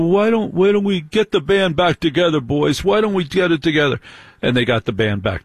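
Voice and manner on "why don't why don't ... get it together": lightly acted eager suggestion, higher voice; mock eager pitch. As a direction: pitch the reunion to your brothers, a touch hammed-up. Voicing a brother proposing a reunion.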